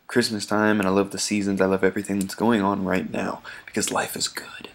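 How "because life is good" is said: whisper. Real, not acted. Aside after gushing about life.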